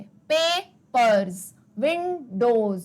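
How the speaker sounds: Papers and Windows drawn out slowly, ending sounds stressed; slow, careful demo of papers and Windows; stretched word endings. Shown as a demonstration. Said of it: "सुनना ध्यान से".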